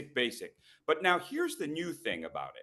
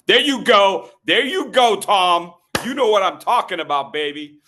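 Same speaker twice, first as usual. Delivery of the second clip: loud, hyped shout to a guy in the crowd; excited call-out; 5 semitones higher, 11 dB louder than usual. Real, not acted. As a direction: cheer him on loudly, fired up. Calling out to audience member Tom.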